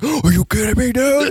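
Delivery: high squeaky disbelief; mock outraged squeal. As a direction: shriek it like the tricked friend. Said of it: "pulled a fucking ice out, like".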